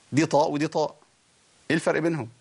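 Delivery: ordinary talk, nothing marked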